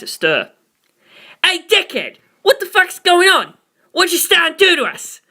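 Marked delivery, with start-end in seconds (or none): 1.4-5.2 much higher, furious outburst; snarling. A narrator's reading. Narancia curses at the narrator while kicking him, snarling high. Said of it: "Narancia snarled".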